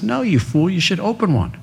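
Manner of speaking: lightly voiced uncle scolding his nephew; uncle's retort. Uncle scolds him at dinner.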